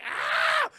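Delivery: very high, loud shriek; acted high-pitched scream; mock scream. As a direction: scream as if debris is falling, hammed up.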